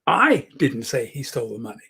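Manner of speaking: "I" leaned on hard, the rest trailing; stress on I. Shown as a demonstration. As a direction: punch the word "I" to deny it was you. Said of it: "emphasis I put on any particular word".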